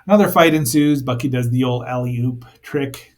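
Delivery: ordinary talk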